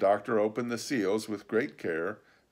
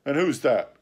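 squire's sharp, louder question; cried out; 4 semitones higher, 7 dB louder than usual. A narrator's reading. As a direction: blurt it out in alarm. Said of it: "cried the squire".

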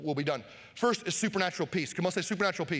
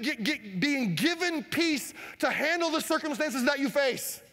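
higher, slow, swelling preacher climax; preaching at full force; 6 semitones higher, slower than usual. Acted. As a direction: drive the point home to the congregation.

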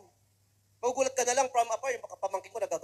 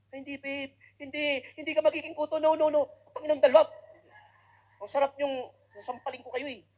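put-on goofy boyfriend; 5 semitones higher, 5 dB louder than usual. Boyfriend answers girlfriend.

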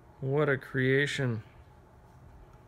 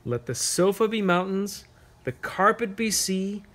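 lilting, higher poem reading, the last line hushed to a whisper; reading a poem to children; 5 semitones higher than usual. Acted. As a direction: read it like a bedtime verse for little ones.

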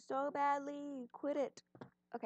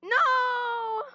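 high loud shriek; 12 semitones higher, 11 dB louder than usual. Real, not acted. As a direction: scream it in dismay. Dies in game.

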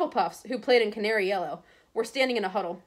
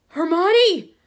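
high, loud anguished call; shocked cry; 10 semitones higher, 12 dB louder than usual. A narrator's reading. Ron seeing Hermione petrified, crying out in shock. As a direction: cry out her name in horror.

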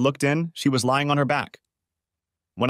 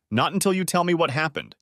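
firm refusal, pitched higher; 6 semitones higher than usual. A narrator's reading. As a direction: hold your ground, insistent. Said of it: "she said firmly".

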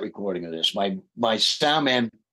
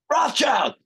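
excited surprise. Retold or imitated. Maiden repeats the song suggestion.

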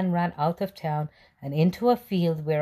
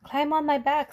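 fox's offer; 10 semitones higher than usual. A narrator's reading. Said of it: "said the fox".

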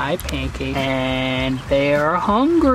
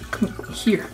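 whispering; 3 semitones higher, 5 dB quieter than usual. Real, not acted. Coaxing turtle to bite.

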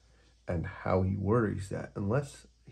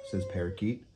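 plain reading voice

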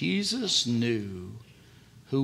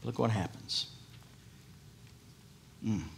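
usual speaking voice

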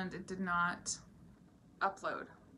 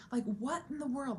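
exasperated retold outburst; 8 semitones higher than usual. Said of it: "really angry".

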